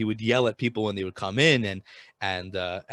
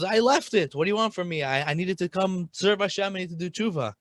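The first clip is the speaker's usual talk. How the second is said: high pleading protest; 7 semitones higher than usual. Acted. Merchant protesting to his Rebbe, high and pleading. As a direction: voice jumps up high, pleading and defensive. Merchant defends leaving wine.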